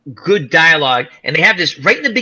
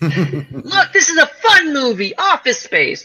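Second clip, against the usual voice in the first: much higher, bright laughing pitch voice; mock cheery sales pitch; 10 semitones higher, slower than usual. Joking how to sell Office Space to chat, high cheery pitch. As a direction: sell the movie like a chirpy host.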